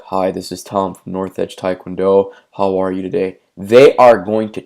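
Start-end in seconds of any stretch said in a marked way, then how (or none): 0.0-3.4 flat bored greeting. Shown as a demonstration. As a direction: drone it like you don't care. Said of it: "if you get on the phone and you're like".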